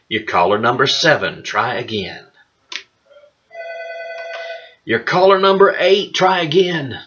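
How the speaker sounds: upbeat radio announcer voice; radio contest promo. Pretend. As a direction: announce each caller cheerfully. Caller countdown continues.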